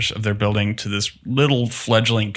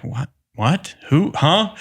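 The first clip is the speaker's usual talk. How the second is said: stammered confusion, pitch climbing higher to a squeak on Huh; mock baffled surprise; 7 semitones higher, slower than usual. Distiller retelling a shock call from a London buyer, mock baffled. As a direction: play it caught totally off guard.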